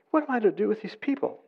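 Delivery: high, hurried plea; crying out. Acted. Moses cries out to the Lord about his people, exasperated plea. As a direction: blurt it out high and fast. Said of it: "Moses cried out to the Lord".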